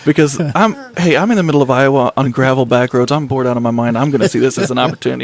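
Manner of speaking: normal talking voice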